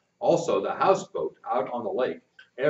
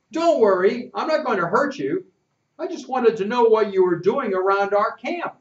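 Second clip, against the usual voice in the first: put-on lightly raised young boy voice; reassuring, a touch higher; boy's voice; 10 semitones higher, 6 dB louder than usual. A narrator's reading. Narrator voicing himself as boy.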